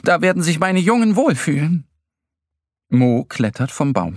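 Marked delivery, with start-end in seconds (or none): 0.0-1.9 high, delighted woodpecker; pleased, raised voice; gushing. A narrator's reading. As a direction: gush happily, pitched up. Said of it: "schwärmt der Specht".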